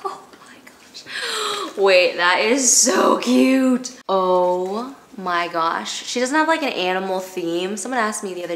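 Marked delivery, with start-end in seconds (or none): 0.0-1.8 high, slow, whispered; whispering slowly over a giraffe basket; awed whisper. Real, not acted. Opens giraffe basket in awe. 1.8-6.0 slow awed gush. Real, not acted. Marvels at the basket.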